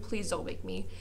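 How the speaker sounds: soft, reluctant begging; hushed retold reluctance; meek plea. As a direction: imitate timid kids pleading quietly. Voices reluctant classmates begging.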